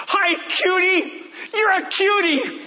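put-on very high little-boy voice, gushing; imitated six-year-old's squeaky greeting; Hardy. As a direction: coo at a monster like a small child. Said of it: "Hardy steps toward him and".

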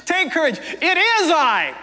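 high, lightly voiced reassurance, slowing at the end; reassuring. Jesus calming his terrified disciples, mildly reassuring. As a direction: soothe frightened friends with a half-smile. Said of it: "Jesus immediately said to them".

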